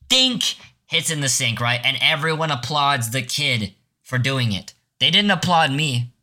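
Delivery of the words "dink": a high little ping of candy hitting metal; high dink sound effect. Retold or imitated. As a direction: chirp the dink like a cartoon sound. Lifesaver hitting the sink.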